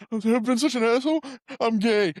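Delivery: high-pitched comic blubbering; hammed-up weepy outburst, laughter breaking in; mock tearful confession. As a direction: imitate someone crying through an announcement. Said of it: "He's crying".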